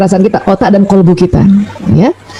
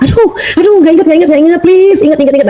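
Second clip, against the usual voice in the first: high, fretful pleading with yourself; panicky pleading; 6 semitones higher than usual. Retold or imitated. Mock panic of someone who can't remember. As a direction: beg your memory to come back, half-panicked. Acting panicked forgetful self-talk.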